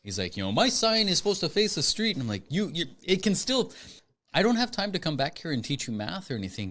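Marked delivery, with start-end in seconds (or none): 0.4-2.1 put-on high, indignant African accent; imitated African accent. Owner complaining to mail carrier, imitated African accent. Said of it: "African accent". 2.5-6.7 exasperated. Retold or imitated. Retold quarrel with shop owner.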